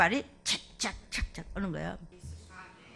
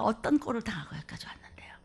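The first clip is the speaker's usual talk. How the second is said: whisper; 2 semitones lower, 5 dB quieter than usual. Real, not acted. Preacher whispering his hardships.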